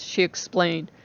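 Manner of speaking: plain reading voice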